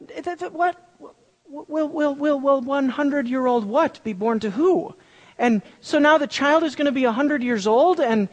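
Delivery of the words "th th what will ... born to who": stammering and lost; muddled. Retold or imitated. Preacher acting Abraham's confusion, stammering. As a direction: stumble over the words in confusion. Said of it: "It's like".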